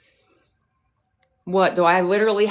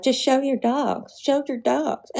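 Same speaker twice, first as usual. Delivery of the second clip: high, slow whiny mimicry of the haters; mocking the haters; 7 semitones higher, slower than usual. Streamer mimics the haters nagging to see her dogs, high mock whine. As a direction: sneer their demand back in a silly high voice. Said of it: "and be like".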